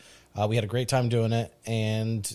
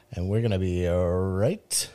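slow, low spooky sign-off; slow theatrical villain menace; 5 semitones lower, 3 dB quieter, slower than usual. Acted. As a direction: draw it out with ghoulish menace.

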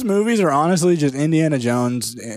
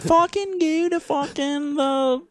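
put-on high, slow valley-girl whine; mock Beverly Hills woman; 9 semitones higher, slower than usual. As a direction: whine it like a spoiled rich girl. Mocking Beverly Hills heroine.

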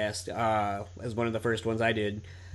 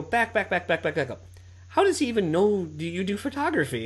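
higher, insistent back back back; retold incredulous demand; 7 semitones higher than usual. Telling his friend to rewind the story, excited disbelief.

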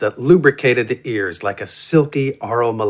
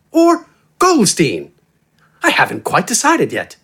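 higher, eloquent gentleman's voice; Wither; 7 semitones higher than usual. A narrator's reading. Dandy introducing himself to the giant, eloquent and friendly. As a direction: introduce yourself with relaxed, flowery polish. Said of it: "He talked in an eloquent, relaxed, and friendly voice".

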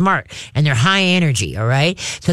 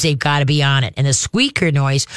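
normal talking voice

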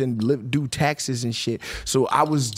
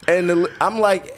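ordinary talk

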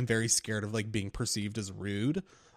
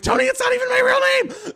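much higher, louder cry through laughter; laughing protest; 10 dB louder than usual. Retold or imitated. Teller retelling how he told the mechanic his real name, laughing protest. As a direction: blurt it out, giggling.

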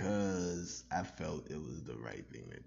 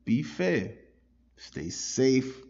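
loud host sign-off; 5 semitones higher, 12 dB louder than usual. Acted. Podcast sign-off to listeners.

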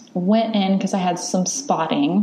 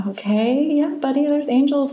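soothing whisper; 8 semitones higher than usual. Retold or imitated. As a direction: hush him gently. Soothing sleepy child at bedtime.

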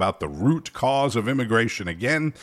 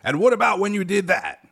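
high, mocking interrogator's voice; hammed-up gotcha interviewer; mock gotcha questions; 7 semitones higher than usual. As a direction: pepper them with needling questions, pitched right up. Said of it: "every question has to be a gotcha question".

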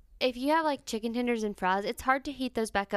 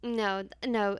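usual speaking voice

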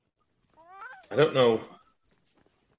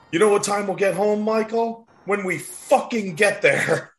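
high, fast yelling dad; angry dad; 8 semitones higher than usual. Retold or imitated. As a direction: snap at the kid from the driver's seat. Voicing angry dad driving.

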